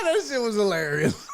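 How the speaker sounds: normal talking voice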